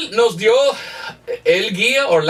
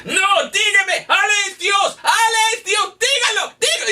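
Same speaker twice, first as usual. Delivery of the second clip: much higher, yelling the demand at him; furious shout; 14 semitones higher than usual. Retold or imitated. Angry imam yelling at the visitor to say it, enraged high shout. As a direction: rage at the visitor, insist he say it. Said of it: "se enojó mucho y dijo".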